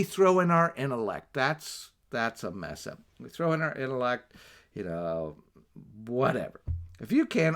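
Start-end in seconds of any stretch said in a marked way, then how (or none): none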